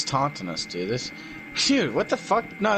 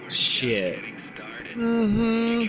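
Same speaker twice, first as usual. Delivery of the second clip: usual speaking voice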